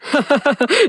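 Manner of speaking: light, teasing chuckle; acted laugh. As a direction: laugh off the grumpy greeting. Caller laughs off the angry greeting.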